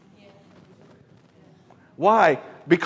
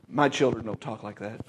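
hushed divine voice; 9 semitones lower, 10 dB quieter than usual. Retold or imitated. Holy Ghost speaks, hushed.

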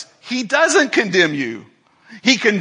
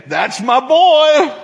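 proud father; 6 semitones higher, 5 dB louder, slower than usual. Pretend. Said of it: "He was saying".

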